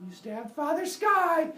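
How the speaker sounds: high, loud, drawn-out mock outrage; upset outcry. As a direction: stretch it out, loudly aggrieved. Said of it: "And he gets upset".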